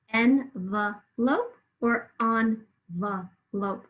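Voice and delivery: Envelope slowly as "en-vuh-lope", then "on-vuh-lope"; syllable by syllable. Shown as a demonstration. Teacher lets pupils hear the second syllable, syllable by syllable. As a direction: draw out each syllable of both Envelope variants. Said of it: "you hear it in the second syllable".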